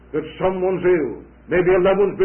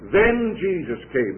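slow, with Then leaned on hard; stress on then; 3 semitones higher, slower than usual. Shown as a demonstration. Preacher stressing the little word to his congregation, slow emphasis on Then. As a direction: lean on the word Then, slowly. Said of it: "The emphasis on the little word "then".

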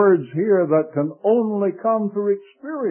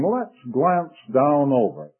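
ordinary delivery

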